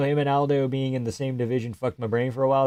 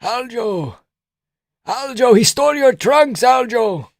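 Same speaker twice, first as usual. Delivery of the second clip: put-on very high, very loud accented shouting; frantic high Georgian coach yelling; Merab impression; 11 semitones higher, 4 dB louder, slower than usual. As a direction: scream from the corner, much higher and louder. Merab voiced corner shouts.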